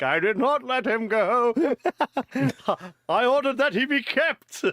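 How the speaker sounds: put-on high, slow outraged old doctor; indignant doctor. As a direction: splutter the denial, laughing. Doctor voiced denying, laughing.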